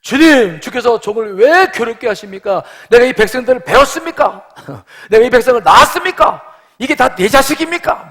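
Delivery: loud, high-pitched outraged complaint; angry protest. Acted. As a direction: hurl furious questions up at God, hammed-up. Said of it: "막 하나님께 화를 내는 거예요".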